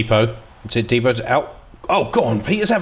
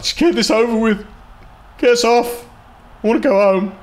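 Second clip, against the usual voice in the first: high whiny players wanting to go home; voicing bored players; 11 semitones higher than usual. Gamer voicing his passing players, whiny and bored. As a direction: whine like players who've given up. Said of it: "they're just passing around like".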